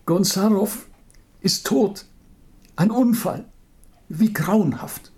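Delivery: high, shaky voice near tears; voice breaking with tears. Acted. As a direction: fight tears as you speak. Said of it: "Tränen kaum unterdrücken". (mit brechender Stimme)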